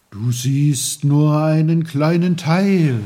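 the sea roars at little Peter, loud; roaring sea. Acted. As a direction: boom it out like crashing waves. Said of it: "brauste das Meer".

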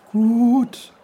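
high, loud, bubbly babbling voice; a merry stream calling out; cheerful brook. Acted. The brook greets Peter loudly and cheerfully.

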